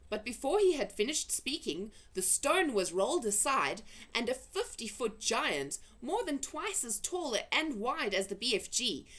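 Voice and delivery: ordinary delivery, nothing marked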